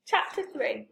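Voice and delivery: ordinary delivery, nothing marked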